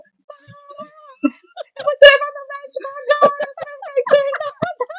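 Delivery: high, loud imitation of a frantic husband, laughing through it; panicked husband, mimicked. Wife imitating her panicked husband, high, loud and laughing. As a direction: play the scared husband shouting, half laughing. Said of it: "ele falou".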